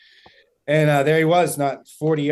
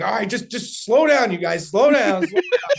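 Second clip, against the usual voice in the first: high, urgent retold plea; raised voice, climbing with each repeat; agitated calming down; 9 semitones higher, 5 dB louder than usual. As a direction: rein in excited friends, pitch rising. Reining in fired-up friends.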